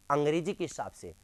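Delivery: ordinary talk, nothing marked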